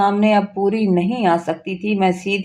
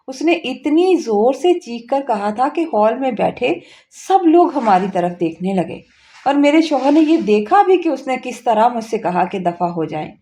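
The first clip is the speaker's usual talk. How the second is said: ordinary delivery, nothing marked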